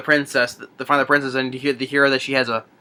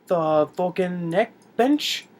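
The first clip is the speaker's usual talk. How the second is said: slow, higher, sharply rising query; mock puzzled question; 4 semitones higher, much slower than usual. Retold hero explaining himself to guard, sheepishly questioning. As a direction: draw it out word by word, unsure.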